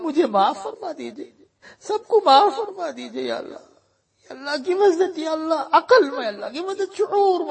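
normal talking voice

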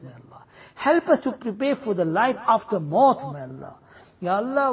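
usual speaking voice

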